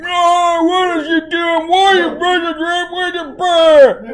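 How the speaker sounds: high-pitched hammed-up wail; exaggerated, over-the-top mock outburst; overdone man parody. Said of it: "you're being a man and being too".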